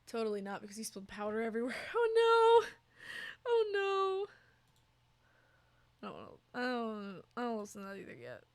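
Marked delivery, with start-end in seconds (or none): 1.9-6.0 high, loud, drawn-out wail; squealed dismay on long notes; exaggerated dismay. Real, not acted. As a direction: wail in playful sympathy. Reacting to spilled powder.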